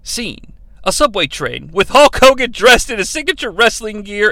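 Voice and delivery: ordinary talk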